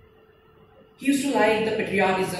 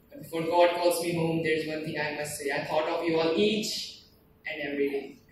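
fading farewell recitation; 2 semitones lower than usual. Acted. Dying soldier taking leave of parents, fading recitation. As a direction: recite like strength is ebbing away.

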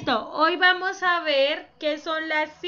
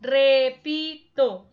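Repito said slowly and louder; syllables drawn out; 5 dB louder, much slower than usual. Shown as a demonstration. Teacher to class, drawing out each syllable. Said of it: "Tres sílabas tiene la palabra repito".

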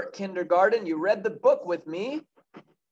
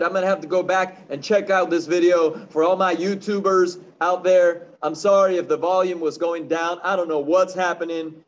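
ordinary delivery, nothing marked

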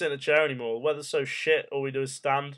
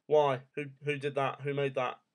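normal talking voice

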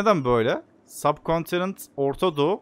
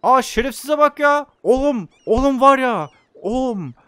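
outraged yell; 9 semitones higher, 7 dB louder than usual. Real, not acted. Outraged at betrayal in game.

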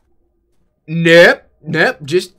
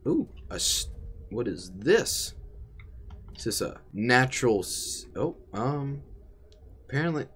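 usual speaking voice

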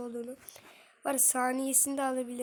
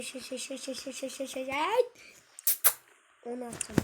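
ordinary delivery

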